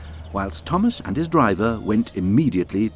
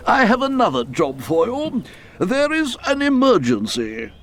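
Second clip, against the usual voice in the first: fat controller voice; 13 semitones higher than usual. A narrator's reading. Fat controller gives orders.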